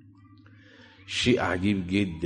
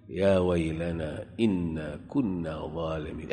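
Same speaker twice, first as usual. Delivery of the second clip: regretful sinners; 4 semitones lower, 6 dB quieter than usual. Acted.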